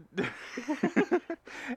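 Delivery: high cackling laugh; giggling fit among friends. Real, not acted. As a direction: let a high laugh break out. Friends joking about dead podcast.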